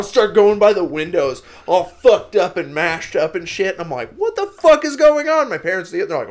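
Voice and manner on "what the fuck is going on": high, loud panicked cry; freaked-out yell; alarmed yell. Retold or imitated. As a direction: shout it as the crowd pours past. Horde of zombies floods the windows.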